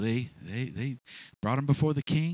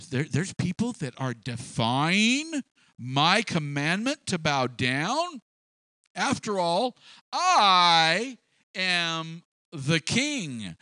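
incredulous royal indignation swelling higher and louder; mad king; 4 semitones higher, 3 dB louder, slower than usual. Acted. King hearing that people defy his commandment, mad and incredulous. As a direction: act out an outraged king pulling rank. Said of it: "King got mad. He said".

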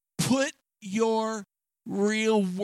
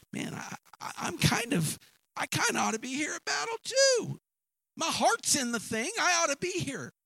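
high, rising eager plea; eager David; 9 semitones higher than usual. Acted. Young David pleading with his brother to stay, eager and high. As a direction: voice David's longing to fight, rising up.

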